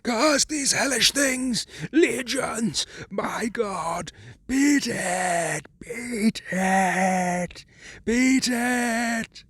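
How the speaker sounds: high cry rising to a horrified shriek; swelling to a shriek. A narrator's reading. Warren shrieking final warning from below, horrified shriek. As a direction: build from whisper to terrified shriek. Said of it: "whisper swelled into a cry ... to a shriek".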